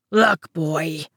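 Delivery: understated troll growl; a hint of a menacing growl; lightly growled warning; mild growl. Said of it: "Olaf growled".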